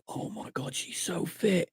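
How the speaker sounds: very soft, breath-only start; awed hushed aside. Retold or imitated. Clubber admiring a girl on the stairs, hushed awe. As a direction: gasp it quietly in retold awe.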